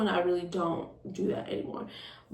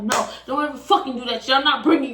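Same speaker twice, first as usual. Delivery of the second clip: louder, raised retold rebuke; mad scolding; 5 semitones higher, 7 dB louder, faster than usual. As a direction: imitate his angry telling-off. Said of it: "He was mad as fuck".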